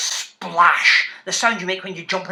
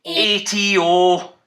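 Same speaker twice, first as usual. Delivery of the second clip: slow spelling; 3 semitones lower, much slower than usual. Shown as a demonstration. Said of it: "I'll say it first and you repeat it".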